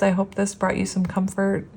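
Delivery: normal talking voice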